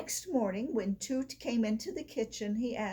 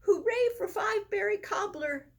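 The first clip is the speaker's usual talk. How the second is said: cheering; 10 semitones higher, 3 dB louder than usual. A narrator's reading. Tulip cheers the cobbler's success.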